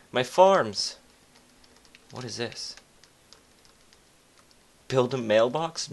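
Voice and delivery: usual speaking voice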